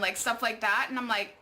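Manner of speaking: ordinary talk, nothing marked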